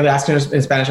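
ordinary talk

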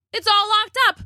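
high-pitched storybook alarm; trapped boy's acted panic; panicked. A narrator's reading. Rob trapped outside shed with T-Rex.

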